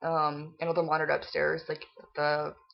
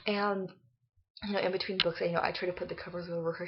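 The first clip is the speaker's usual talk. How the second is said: ordinary delivery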